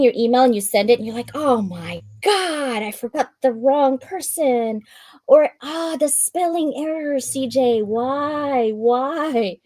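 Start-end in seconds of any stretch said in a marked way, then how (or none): 1.3-4.8 high, drawn-out wail of horror; mock dismay. Acts out email sending panic. 5.3-9.6 high, drawn-out theatrical wail; mock anguish. Acts out spotting email typo.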